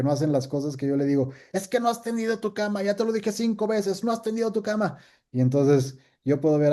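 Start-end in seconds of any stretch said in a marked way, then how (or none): 1.5-5.0 high, fast nagging parent; mock scolding of the kids. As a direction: nag like a fed-up parent.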